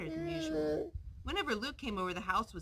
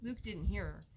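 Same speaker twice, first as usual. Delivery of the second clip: ordinary delivery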